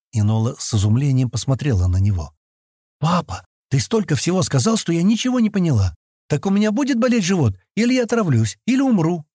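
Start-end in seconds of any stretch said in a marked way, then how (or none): 3.0-9.3 put-on high-pitched childish questioning; a girl piling up questions to her dad, pitched high; puzzled little girl. A narrator's reading. (недоумевающая девочка, высоким голосом)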